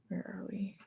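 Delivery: quick whisper to the table; whispered aside. Real, not acted. Player lost in the comic whispers to friends, hushed. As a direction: hush it under your breath. Aside while losing place.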